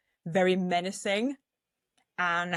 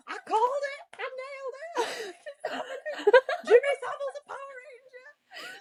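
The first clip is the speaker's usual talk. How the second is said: very high, laughing giddy squeal; laughing shriek; faster than usual. Retold or imitated. To her friend at the cinema, shrieking with laughter. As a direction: squeal it through laughter, losing it.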